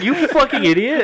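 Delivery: high, loud affectionate insult; laughing insult. Retold or imitated. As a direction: scold him through a laugh.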